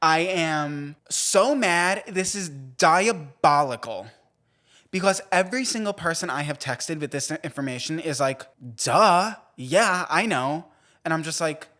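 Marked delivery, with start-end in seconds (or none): 0.0-4.1 slow, emphatic mock fury; hammed-up outrage. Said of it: "how infuriating it has been". 8.8-10.7 loud, higher imitation of a know-it-all reply; mocking duh. Imitating everyone's dismissive reply.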